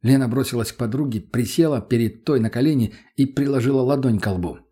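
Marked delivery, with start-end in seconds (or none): none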